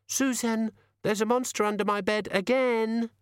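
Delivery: put-on high child's voice; small child reporting a monster to a grown-up; high-pitched little girl; plaintive little girl. A narrator's reading.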